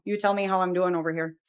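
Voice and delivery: ordinary talk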